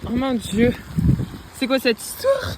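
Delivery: overwhelmed, shouting with delight; excited shriek. Real, not acted. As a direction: squeal it out in disbelief. Overwhelmed by the landscape.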